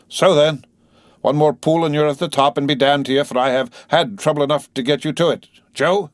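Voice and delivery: high-pitched holler at the horses; coachman urging horses. A narrator's reading. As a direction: urge the team on, high and loud.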